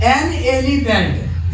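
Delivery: एन elephant said slowly and clearly; slow model phrase. Shown as a demonstration. Teacher slowly modelling the article and noun. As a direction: stretch out एन elephant for the class. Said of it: "जैसे".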